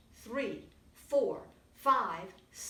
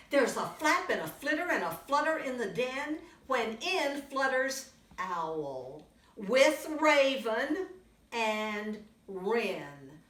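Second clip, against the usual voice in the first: put-on lively read-aloud for little kids; storybook reading; 7 dB louder than usual. A narrator's reading. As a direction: tell it brightly to a young audience. Reads picture book to children.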